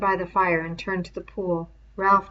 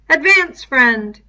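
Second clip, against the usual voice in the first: sentry call; 7 semitones higher, 12 dB louder, slower than usual. A narrator's reading. Sentry lets Roger pass.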